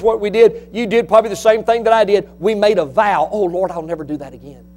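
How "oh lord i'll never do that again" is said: low, hushed, hurried promise to God; quiet vow. Retold or imitated. Parent vowing to the Lord after giving in, quiet and quick. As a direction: say it softly and quickly, under the voice.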